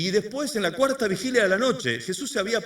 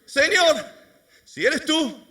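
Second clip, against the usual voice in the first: eager Pedro; 7 semitones higher, 4 dB louder, much slower than usual. Retold or imitated.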